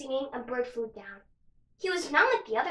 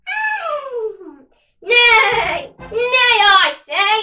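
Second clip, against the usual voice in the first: high, loud neighing horse voice; whinnying horse; 8 semitones higher, 12 dB louder, much slower than usual. Acted.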